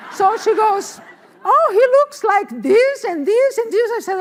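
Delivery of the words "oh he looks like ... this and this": high, loud, gushing description; excited retold quote. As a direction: act out an eager friend describing him. Acts out friend's eager description.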